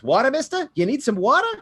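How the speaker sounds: high, pushy offer of water; offering water to a tired player, high and eager; eager water boy. Acted. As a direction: sidle up and sell him water. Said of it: "And he goes".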